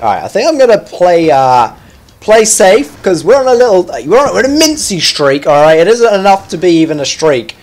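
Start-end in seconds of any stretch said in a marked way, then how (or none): none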